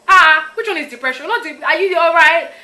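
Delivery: high, loud dismissive Nigerian grandma; imitating grandma. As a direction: brush it off like a scolding grandma. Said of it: "And she'd say".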